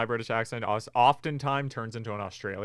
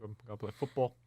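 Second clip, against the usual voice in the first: a hint of British accent, softer; 6 dB quieter than usual. Shown as a demonstration. Finishing the requested demo, light British accent. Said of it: "can you do a British accent".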